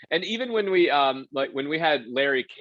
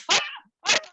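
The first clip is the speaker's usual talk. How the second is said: panicked yelp; 3 dB louder than usual. Retold or imitated. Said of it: "I'm reading this card".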